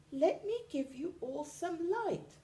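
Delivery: high tiny fly voice; high helpful fly; little fly. A narrator's reading. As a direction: offer it high and kind. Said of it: "said the fly".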